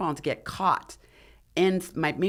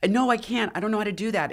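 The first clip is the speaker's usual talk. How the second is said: higher-pitched panicky refusal, no sobbing; retold tearful protest; 7 semitones higher, faster than usual. Crying climber telling her rescuer she can't glissade, mildly panicked protest. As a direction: re-enact a frightened plea. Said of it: "And I'm crying".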